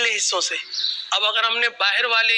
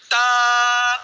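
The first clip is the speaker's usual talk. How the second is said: تا called out louder; loud letter; 2 semitones higher, 5 dB louder than usual. Shown as a demonstration. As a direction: announce ta clearly for the children. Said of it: "دو نقطے والا". (تا اونچی آواز میں)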